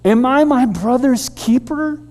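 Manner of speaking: usual speaking voice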